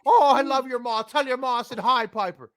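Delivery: mock him like Roddy Piper. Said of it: "do the promo in his voice".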